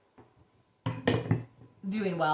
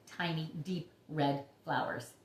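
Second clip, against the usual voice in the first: ordinary delivery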